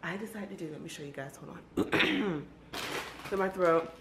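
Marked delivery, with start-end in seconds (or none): none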